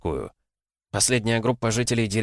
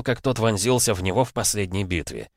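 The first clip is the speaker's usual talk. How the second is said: ordinary delivery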